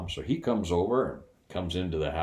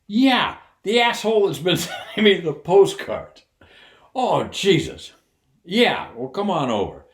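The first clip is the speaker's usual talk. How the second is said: excited retelling; 12 semitones higher, 9 dB louder than usual. Retold or imitated. Mark tells wife on phone.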